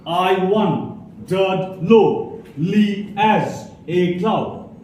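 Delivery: "I wondered lonely as a cloud" slowly, every second syllable stressed; iambic stress. Shown as a demonstration. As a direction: beat out "I wondered lonely as a cloud" in slow iambs. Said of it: "the second syllable is stressed".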